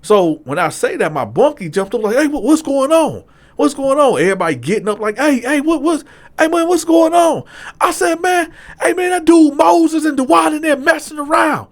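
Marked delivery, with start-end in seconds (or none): 2.2-4.2 high, loud confused alarm; startled awake and demanding answers; a jolted cellmate calling out; alarmed wake-up. Retold or imitated. 5.2-7.4 high, loud overlapping alarm; everybody shouting what's happening; loud confusion. Retold or imitated. Everyone waking up. 8.2-11.7 high, loud shout across the tier; yelling out who is doing it; shouted call-out. Retold or imitated. Calling out the culprits to the tier.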